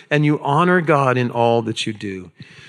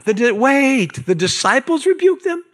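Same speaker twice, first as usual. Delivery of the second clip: mock disbelief; 10 semitones higher than usual. Preacher reacting to the disciples rebuking parents, mock astonishment. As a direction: stop short as if you can't believe it. Preacher feigns shock at text.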